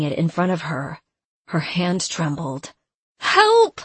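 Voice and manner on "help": high, loud cry for help; scream. Acted. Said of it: "she screamed".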